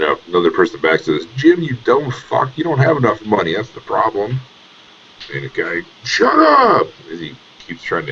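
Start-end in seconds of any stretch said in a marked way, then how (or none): 1.4-4.4 scornful. Acted. Bystander insulting Jim. 6.0-6.9 shouted shut up. Acted. Jim snapping at bystander.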